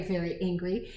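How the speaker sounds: plain reading voice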